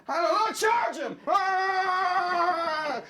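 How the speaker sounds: high, excited shout into a held cry; battle-charge yell. Acted. Costumed pair charge at Artie.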